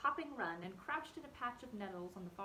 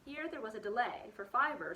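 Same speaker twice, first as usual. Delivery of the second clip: usual speaking voice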